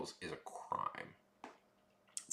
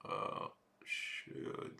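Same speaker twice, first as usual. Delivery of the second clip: breathy whisper, no voice; 10 dB quieter than usual. Real, not acted. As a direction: whisper it under your breath. Whispered reaction.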